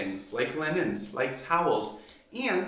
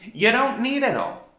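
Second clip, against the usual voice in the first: emphatic shout; 8 semitones higher, 9 dB louder than usual. Real, not acted. Emphatic rant to viewers.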